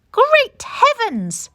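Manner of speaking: high, loud and slow gasp of surprise; surprised exclamation. Acted. Missus Armitage caught by sudden rain, loud high surprised exclamation. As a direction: cry out as the rain starts.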